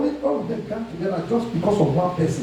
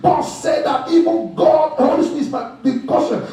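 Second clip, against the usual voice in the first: sustained, emphatic sermon delivery; forceful preaching; 5 semitones higher than usual. Real, not acted. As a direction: drive the teaching home to the congregation.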